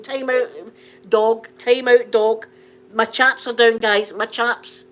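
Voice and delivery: loud, slow calling out; mock loud plea. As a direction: imitate a man urgently protesting to police. Acted plea of arrested man.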